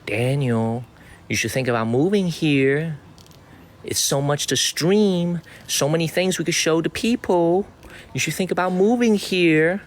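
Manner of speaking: lightly voiced urging to move; teasing retelling of the assistant's pitch; imitating Harry. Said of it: "Harry was like".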